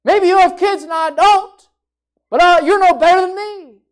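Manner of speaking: indignant shout-down; hypothetical retort. Pretend. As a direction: snap back at a rival. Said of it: "Hannah didn't say".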